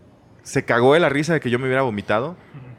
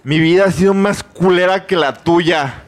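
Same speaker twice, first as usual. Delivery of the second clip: loud, slow, higher retort; aggressive; 5 semitones higher, 6 dB louder, slower than usual. Retold or imitated. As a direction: snap back with a bruised ego. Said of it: "yo empecé a contestar bien agresivo".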